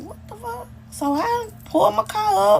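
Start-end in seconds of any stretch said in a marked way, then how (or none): 0.0-0.7 high, very soft disbelieving breath; hushed stunned what the fuck. Retold or imitated. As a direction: gasp it out in shock. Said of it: "So I'm like".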